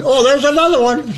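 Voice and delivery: high, gawking oh; naive amazement. Retold or imitated. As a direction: sound like a gawking dummy. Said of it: "like a bunch of dummies".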